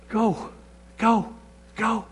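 soft repeated "Go"; gently coax, under the breath; soft urging. Pretend. Preacher voicing God quietly prodding the believer.

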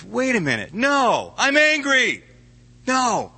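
high, loud outburst, catching himself getting mad again; angry outburst. Acted. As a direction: Jeremiah snaps back at God in a high, loud outburst. Jeremiah flares up again.

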